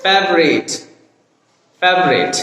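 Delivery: ফেভারিট squeezed to "fev-rit"; correct pronunciation. Shown as a demonstration. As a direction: drop the middle vowel of ফেভারিট, fev-rit. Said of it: "আমরা কি উচ্চারণ করব তাহলে". (শুদ্ধ উচ্চারণে ফেভারিট, "ফেভ-রিট")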